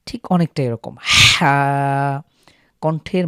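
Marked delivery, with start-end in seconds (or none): 1.0-2.2 হা pushed from the middle of the throat, louder; pressed throat ha. Shown as a demonstration. Tutor demonstrating a pressed, throaty হা. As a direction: squeeze হা out from mid-throat. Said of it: "কণ্ঠনালীর মাঝখান থেকে চাপ দিয়ে".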